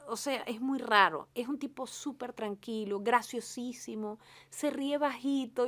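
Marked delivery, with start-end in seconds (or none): none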